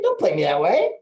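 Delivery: imitating a fun, joshing protest; playful teasing. [name] ribbing a client for holding back names, playful. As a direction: tease a friend for holding out. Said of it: "if you deliver it like it's fun".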